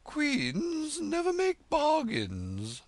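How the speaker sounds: slow, high, loud haughty refusal; stiffly. A narrator's reading. Red Queen stiffly refusing Alice's bargain. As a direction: draw yourself up and refuse with dignity. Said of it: "drew herself up rather stiffly".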